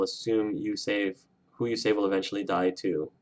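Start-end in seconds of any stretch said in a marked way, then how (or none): none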